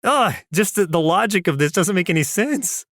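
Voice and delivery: normal talking voice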